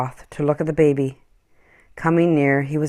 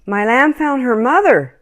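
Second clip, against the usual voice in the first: lightly voiced boy, higher and eager; 9 semitones higher, 5 dB louder than usual. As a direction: claim it quickly like an eager child. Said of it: "quickly Matthew claimed them".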